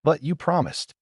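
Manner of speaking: high, bewildered protest. A narrator's reading. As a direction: object in disbelief. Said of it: "almost bewildered".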